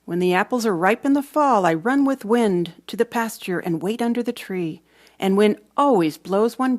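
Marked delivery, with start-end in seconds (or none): none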